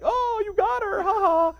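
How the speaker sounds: very high mock fan voice; squeaky teasing fan praise; mock listeners. Listeners congratulating him on the prank, very high mock voice.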